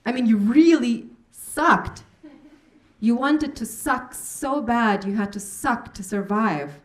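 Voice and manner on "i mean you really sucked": slow, loud, stressing really; emphatic. Acted. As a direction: lean hard on really. Performance piece, emphatic opener.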